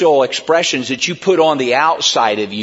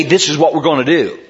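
firm declaration. Shown as a demonstration. Preacher showing the flock how to declare the plan. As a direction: state it flatly as a settled plan. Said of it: "You can say".

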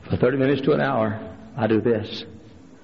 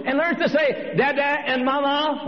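higher-pitched, mildly mimicked baby talk; baby's first words; 11 semitones higher than usual. Preacher retelling a baby's first words, mildly mimicked. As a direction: lightly imitate a baby learning to talk.

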